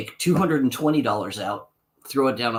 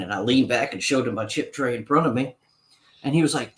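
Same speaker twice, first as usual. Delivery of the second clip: normal talking voice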